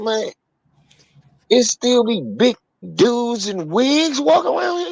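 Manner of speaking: high, incredulous mock question; retold disbelief. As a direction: question him like you can't believe it.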